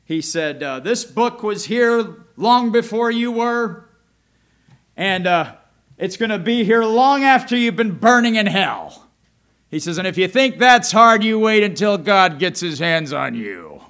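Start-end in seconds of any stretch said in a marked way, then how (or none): 0.8-9.1 loud, high, slow stern rebuke; harsh preacher quote. Retold or imitated. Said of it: "he would say things like this". 10.0-13.8 harsh preacher quote. Retold or imitated. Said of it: "that's how he would say it".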